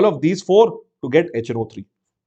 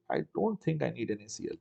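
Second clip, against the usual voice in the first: much softer, under the breath; quiet repeat to himself; muttered to self; 6 semitones lower, 14 dB quieter than usual. Real, not acted. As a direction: mutter it to yourself as you check.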